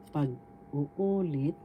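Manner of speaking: pag-uulit slowly, holding the second "u"; stress on third syllable. Shown as a demonstration. Teacher giving another example of retell, stress on third syllable. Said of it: "The third syllable has the accent".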